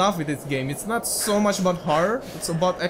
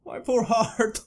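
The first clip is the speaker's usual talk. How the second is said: shaken; 5 semitones higher, slower than usual. Real, not acted. Recovering from ghost jump scare.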